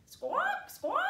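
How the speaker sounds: very high, loud parrot squawks. Shown as a demonstration. Teacher to the children, showing a parrot squawk. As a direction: screech it out like a parrot. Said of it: "Can you squawk like a parrot?".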